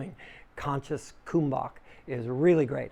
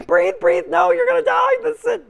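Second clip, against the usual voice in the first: very high, loud panicked shriek; frantic alarm voice; panicking reptile brain; 14 dB louder than usual. Pretend. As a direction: scream that you're about to die. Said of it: "the reptilian brain starts to go".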